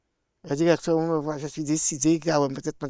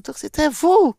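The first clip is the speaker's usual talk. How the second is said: surprised question; 9 semitones higher, 6 dB louder than usual. Retold or imitated. Director recognises her, surprised.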